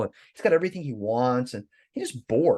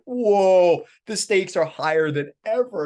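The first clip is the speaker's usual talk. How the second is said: amazed; 5 semitones higher, 5 dB louder, slower than usual. Retold or imitated. Pip amazed at new table.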